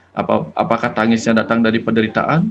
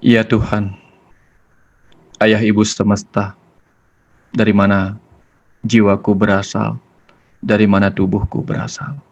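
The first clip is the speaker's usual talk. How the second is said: guided prayer; 2 semitones lower than usual. Acted. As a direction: lead the listeners gently into silent prayer. Leads a closing prayer. (doa yang tenang dan pelan)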